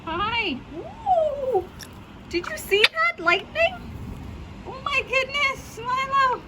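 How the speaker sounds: put-on high, sing-song baby voice; baby talk. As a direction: coo at the little one like a parent. Baby talk about lightning.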